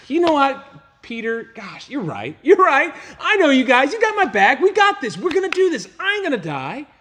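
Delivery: high, loud, pumped-up agreeing; mock agreement. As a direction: play a fake hyped-up Jesus going along. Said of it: "of course that's not what Jesus said".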